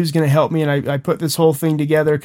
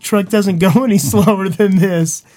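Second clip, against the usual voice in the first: exasperated buddy; 5 semitones higher, 4 dB louder than usual. Retold or imitated. Buddy driving the truck tells his injured friend off, exasperated. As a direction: play the buddy who can't believe the complaint.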